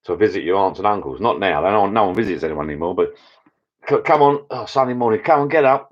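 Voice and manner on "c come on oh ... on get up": higher, hurried mock parent calling the kids up; parent rousing kids. Parent waking the kids for Sunday visit, higher mock call. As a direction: chivvy the family out like a parent.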